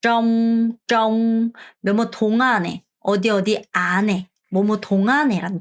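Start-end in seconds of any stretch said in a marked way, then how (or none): 0.0-1.5 정 begun with an R-like curled tongue and held long; R pronunciation. Shown as a demonstration. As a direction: draw out 정 slowly on a level, sustained note. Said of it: "R 발음해서".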